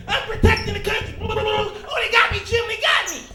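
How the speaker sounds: high, loud hero caught mid-fight; mock war hero. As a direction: play a would-be hero getting captured.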